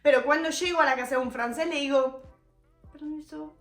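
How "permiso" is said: a soft, barely voiced permiso; said quietly. Shown as a demonstration. Asking permission at a French home, murmured very softly. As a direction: murmur permiso under your breath so only your conscience hears. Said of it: "Tipo, lo digo bajito".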